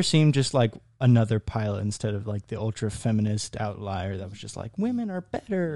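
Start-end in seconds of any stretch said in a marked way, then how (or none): 4.8-5.8 high-pitched mocking slogan; mock proclamation. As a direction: imitate a preachy outlier in a raised pitch. Mocks an ultra-feminist movie stereotype.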